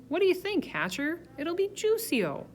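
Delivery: boisterous guest holding forth; Mister Yarby, jovial. A narrator's reading.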